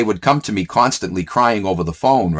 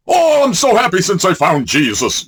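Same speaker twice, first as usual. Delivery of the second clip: fervent new convert; 7 semitones higher than usual. Retold or imitated. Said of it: "they're trying to win them over".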